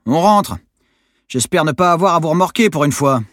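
loud, high-pitched hollered command; bellowed order. A narrator's reading. Monitor warning the stranded crew, bellowed. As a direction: holler so the boats can hear. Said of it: "gueula Yo-Yo".

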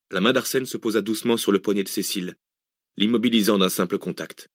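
ordinary delivery

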